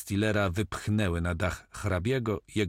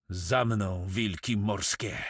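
plain reading voice

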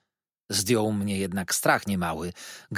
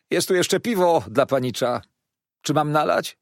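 louder, higher serving voice; waiter's offer; 6 semitones higher, 5 dB louder than usual. A narrator's reading. Waiter offering the young master beer, lively offer.

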